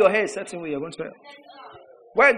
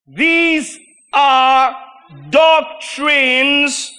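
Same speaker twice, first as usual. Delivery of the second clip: full-voiced higher preaching, much louder; preaching shout; 7 semitones higher, 7 dB louder, much slower than usual. Real, not acted. Preacher thundering scripture at the church, a loud high shout. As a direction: thunder it at the congregation.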